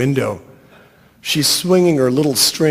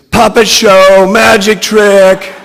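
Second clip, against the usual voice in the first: high, very loud scream; 7 semitones higher, 11 dB louder, slower than usual. A narrator's reading. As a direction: scream it out, dragging the last words. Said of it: "And Brittany screams".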